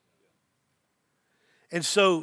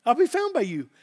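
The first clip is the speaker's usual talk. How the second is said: raised emphatic repeat; 5 semitones higher than usual. Acted. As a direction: lift it high and press it home.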